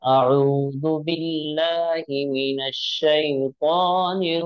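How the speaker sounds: slow, measured recitation of the phrase. Shown as a demonstration. Teacher reciting for students to repeat. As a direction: recite it slowly for them to repeat. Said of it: "पढ़िए, आप इसको दोहराइए".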